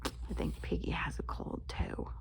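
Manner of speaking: soft quick whisper; storyteller's whispered aside to the kids; hushed aside. A narrator's reading. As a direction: lean in and whisper the joke.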